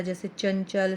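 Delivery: usual speaking voice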